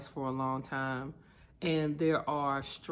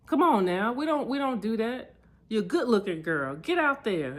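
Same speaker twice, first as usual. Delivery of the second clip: louder, higher tough-love dad push; rally her like a coach father; dad's pep talk; 7 semitones higher, 7 dB louder than usual. Retold or imitated.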